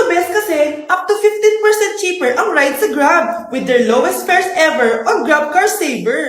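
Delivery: loud, upbeat sponsor pitch; punchy promo read. Acted. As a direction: sell the ride app cheerfully. Reads the sponsored Grab ad.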